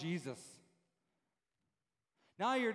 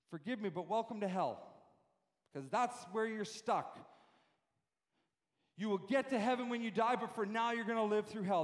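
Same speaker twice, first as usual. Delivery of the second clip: normal talking voice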